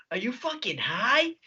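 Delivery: mock accusation. Dave accusing the teller over red eyes, incredulous. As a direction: imitate an accusing coworker, pitch climbing.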